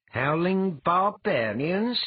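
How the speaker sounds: slow, high incredulous question; indignant objection. A narrator's reading. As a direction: echo the words back, offended. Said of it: "Eliar objected".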